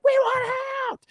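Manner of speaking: very high shouted demand; desperate shout. Retold or imitated. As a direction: yell to escape. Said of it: "And we're like".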